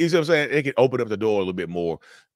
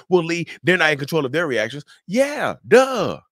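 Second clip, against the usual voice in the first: mock the objector, pitched way up; 8 semitones higher, 5 dB louder than usual. Host parroting a doubting viewer and scoffing back, mocking.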